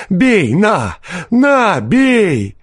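very high, frenzied dare; fierce. A narrator's reading. Peasant daring forester to hit him, fierce voice. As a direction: egg him on in fury. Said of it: "подхватил мужик свирепым голосом".

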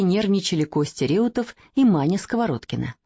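usual speaking voice

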